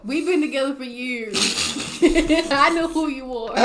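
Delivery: mocking while laughing. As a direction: parrot him through giggles. Mocking his protest.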